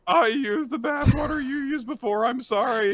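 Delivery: high pleading voice; whiny apology. Retold or imitated. Apologizing to her in a high whiny voice. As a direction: squeak out a pitiful sorry. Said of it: "He's like".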